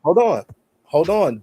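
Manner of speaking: firm, loud repeated hold-on; insistent interruption. Real, not acted. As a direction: talk over them to halt them. Cutting off an interrupting co-host.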